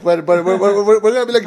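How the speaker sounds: ordinary talk, nothing marked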